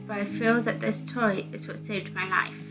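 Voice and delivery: ordinary talk, nothing marked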